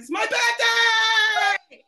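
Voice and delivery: high, loud joyful yell; shouting her own birthday news. Real, not acted. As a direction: announce it like a party.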